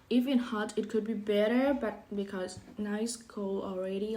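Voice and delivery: normal talking voice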